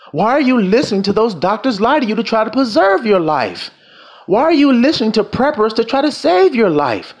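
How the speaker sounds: high-pitched, urgent sermon delivery; fervent preacher building to a high pitch; impassioned preaching. Acted. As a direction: preach it high, each challenge ringing out. Preaches at full force.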